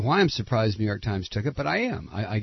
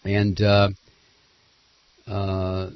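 ordinary delivery

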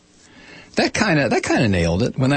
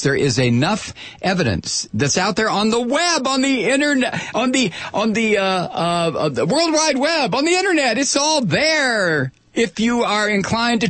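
rising rant; 9 semitones higher than usual. Real, not acted. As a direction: voice climbing higher as he rants.

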